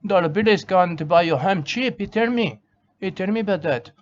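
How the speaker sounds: ordinary talk, nothing marked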